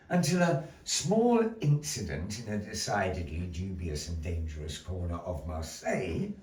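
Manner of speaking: ordinary talk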